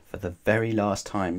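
ordinary delivery, nothing marked

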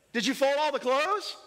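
mock dad cornering a kid over laundry; mock parent interrogation. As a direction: play the parent who already knows. Parent questioning child over laundry.

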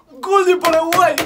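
high, loud goal yell; goal shout. Real, not acted. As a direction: scream it like a commentator.

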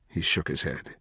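plain reading voice

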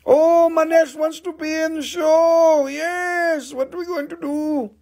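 very high, hammed-up wail; mock wailing. Seller fussing over who joins the show, mock wailing. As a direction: cry out in playful, squealed dismay. Mock dismay at live sale.

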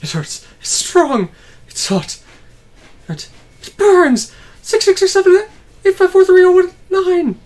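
pained character voice. Acted. Voicing a character in agony.

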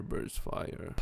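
"burst fire" whispered softly. Real, not acted. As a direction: whisper it to yourself.

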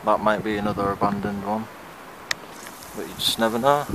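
usual speaking voice

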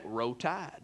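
low, quiet Southern "Roll Tide"; good old boy drawl. Shown as a demonstration. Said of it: "you really gotta say".

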